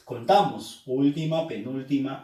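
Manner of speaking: usual speaking voice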